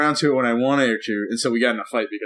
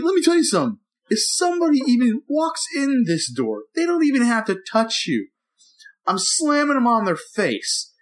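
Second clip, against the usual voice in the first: retold angry lecture; 12 semitones higher than usual. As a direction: lay down the law to your wife, fired up. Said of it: "And I got so mad".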